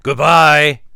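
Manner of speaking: very loud, gruff bear call; hearty, far-carrying bear farewell; big gruff voice. A narrator's reading. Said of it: "a big gruff voice".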